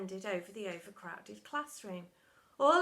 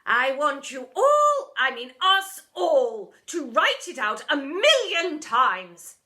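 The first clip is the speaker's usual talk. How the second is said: loud, slow, higher bark; 4 semitones higher, 14 dB louder, slower than usual. A narrator's reading. Deputy ordering the school from the cabinet, a loud bark. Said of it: "she barked".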